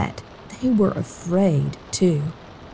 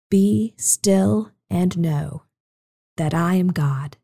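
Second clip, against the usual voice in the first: slow recitation; slower than usual. Shown as a demonstration. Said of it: "The words are simply".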